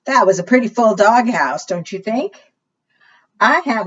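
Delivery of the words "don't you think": ordinary delivery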